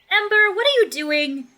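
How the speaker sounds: put-on mother calling sharply to her wandering child; calling after child. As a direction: call the kid back across the street.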